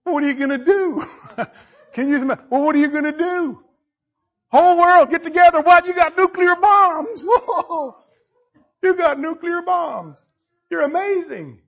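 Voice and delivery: high, laughing taunt; tickled mockery. Preacher as God tickled by the angry world, high mocking amusement. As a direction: play God amused at puny threats. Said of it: "getting tickled".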